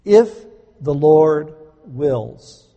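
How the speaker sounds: slow and loud, each word weighed; solemn emphasis. Shown as a demonstration. Preacher teaching the phrase, slow and emphatic. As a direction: lay out "If the Lord wills" slowly. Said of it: "this is what we ought to say".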